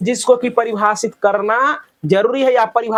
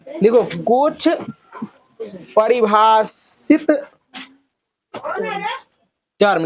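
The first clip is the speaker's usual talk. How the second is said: very slow, word by word with long pauses; slow dictation; much slower than usual. Real, not acted. Teacher dictating for pupils to write down, slow dictation. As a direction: dictate it piece by piece, slowly. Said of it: "मैं slow slow बे-, बोल रहा हूँ".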